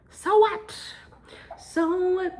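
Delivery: high, loud, defiant burst; loud defiance. Real, not acted. Vlogger refusing to whisper at night, loud defiant shout. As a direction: throw it out loud and high, neighbors be damned. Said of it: "I'll speak loudly".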